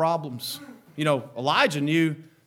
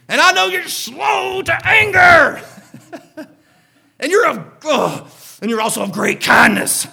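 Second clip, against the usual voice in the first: whining Jonah; 10 semitones higher, 12 dB louder than usual. Acted. Jonah complaining God is kind.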